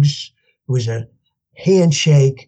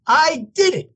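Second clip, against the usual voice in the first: re-enacted excitement; triumphant announcement; 11 semitones higher than usual. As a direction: brag to your sponsor, voice soaring. Retold proud call to sponsor.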